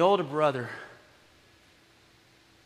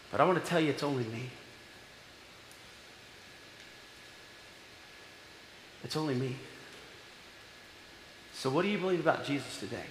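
quiet altar call; 2 semitones lower, 6 dB quieter than usual. Acted. Preacher quietly appealing to the congregation, soft and searching. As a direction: ask each listener gently where they stand.

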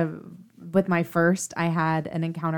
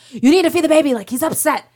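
louder, higher stern nurse; 8 semitones higher, 6 dB louder, faster than usual. Retold or imitated. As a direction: scold like an impatient nurse. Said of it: "she's like".

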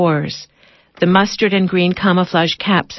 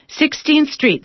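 calling out; 9 semitones higher, slower than usual. A narrator's reading. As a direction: stop called out high. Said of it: "the driver calls out".

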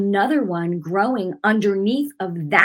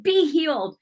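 healing declaration; 7 semitones higher than usual. Retold or imitated.

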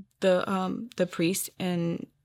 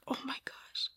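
very soft, higher hushed gasp of words; overwhelmed whisper; 6 semitones higher, 15 dB quieter than usual. Retold or imitated. Speaker overcome and crying after service, retold overwhelmed whisper.

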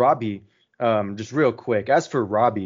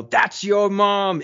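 slow, higher imitation of a deadpan comeback; Idris Elba's line; 8 semitones higher, much slower than usual. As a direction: drag out the your-mom line in a lightly voiced imitation. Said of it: "that delivery of Idris Elba".

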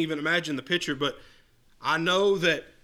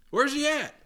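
higher, falling, pointed taunt; sarcastic mock search, higher and dropping; 9 semitones higher than usual. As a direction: press the jab home, landing on at. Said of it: "Elijah mocked them and said".

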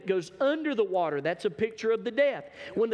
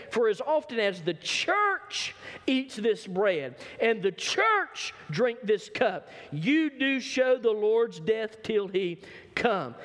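slow, higher, deliberate reading; slow emphasis on the church; 4 semitones higher, slower than usual. Acted. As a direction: press the church home slowly. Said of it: "The ye is the church".

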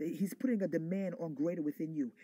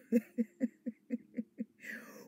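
bright, high, delighted laugh; joyful laugh; 7 semitones higher than usual. Real, not acted. Preacher laughing at her own zeal, joyful laugh. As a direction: laugh out in joy.

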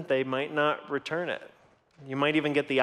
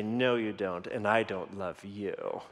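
put-on lower, softer sly child; low teasing kid; 6 semitones lower, 5 dB quieter than usual. Son pushing his dad further, low and sly. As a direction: push it further like a cheeky son.

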